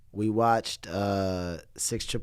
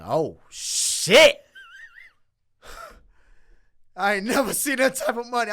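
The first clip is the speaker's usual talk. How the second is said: loud, high, stunned exclamation; shocked outburst; 11 semitones higher, 9 dB louder than usual. Retold or imitated. Reacting to the pay offer, a loud shocked outburst. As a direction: blurt it out in disbelief.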